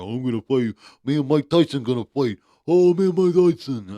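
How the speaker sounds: loud, rising mock rant in a put-on voice; mocking John Fury. Podcast host mocks the fighter's loudmouth dad, building mock rant. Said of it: "John is a little annoying".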